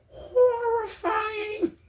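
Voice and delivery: very slow, high stretched horrifying; drawn-out horrifying. Real, not acted. Stressing how awful the videos were, slow and high.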